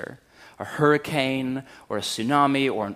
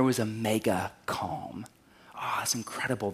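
whispered calm; 4 semitones lower, 8 dB quieter than usual. Acted. Contrast to mega storm.

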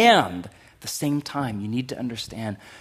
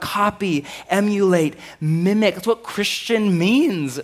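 higher-pitched, emphatic preacher's charge; forceful preaching; 8 semitones higher, 6 dB louder than usual. Real, not acted. Preacher telling God's children to copy Jesus, emphatic preaching. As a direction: press each word on the congregation.

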